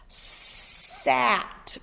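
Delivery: sat with a hissed s; sounded example. Shown as a demonstration. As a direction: stress the s in sat. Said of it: "such as".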